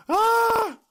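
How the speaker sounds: high, loud yell of frustration; exasperated scream. Real, not acted. Podcaster fed up with dog license paperwork, frustrated scream.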